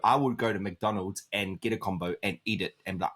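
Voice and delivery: usual speaking voice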